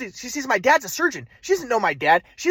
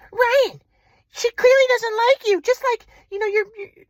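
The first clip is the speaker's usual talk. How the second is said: mom's voice; 14 semitones higher, 3 dB louder than usual. Retold or imitated. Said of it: "but my mom".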